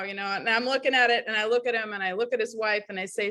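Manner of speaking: normal talking voice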